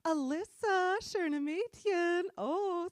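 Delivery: high, loud, drawn-out leer; imitating skeezy dudes. Creeps sweet-talk the friend in German, hammed-up leer. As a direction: croon it slowly and creepily. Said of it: "these skeezy, slimy dudes".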